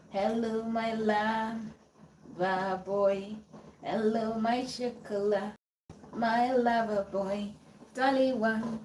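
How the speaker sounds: soft crooning purr; breathy vintage purr. Pretend. Crooning pet names to a love song.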